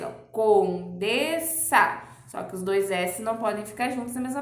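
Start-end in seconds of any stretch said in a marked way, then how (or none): 0.3-2.0 "condensa" spoken very slowly, each syllable opened; slow, exaggerated "condensa" for the class; syllables drawn out. Shown as a demonstration. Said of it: "três vezes". ("condensa" bem devagar, sílaba por sílaba)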